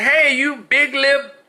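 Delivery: high, slow, shouted insult at a man on a train; mock angry yell. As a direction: bark it out as a mock enraged stranger.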